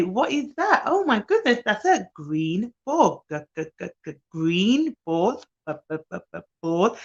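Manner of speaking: put-on wow a toddler with the green ball; excited nanny to toddler. Shown as a demonstration. Nanny playing with a toddler, excited sing-song. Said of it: "So I would say".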